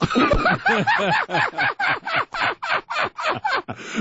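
high drawn-out laugh at her own story; long laugh. Real, not acted. Caller laughing at her own wallet story, long laugh.

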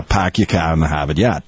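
Boston accent with every R dropped; stock Boston accent. Shown as a demonstration. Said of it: "when people do the Boston accent, they're always just doing the R's".

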